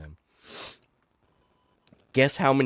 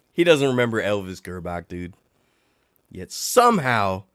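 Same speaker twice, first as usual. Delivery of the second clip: usual speaking voice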